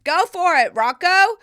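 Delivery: high excited cheer. Retold or imitated. Mom cheering Rocco on to speak Spanish, high excited shout. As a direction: hype him up like a proud cheerleader. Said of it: "I said".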